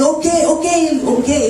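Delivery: high put-on voice of the Japanese man replying; lightly voiced Japanese passer-by; imitating the Japanese man. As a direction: mimic the stranger shrugging it off.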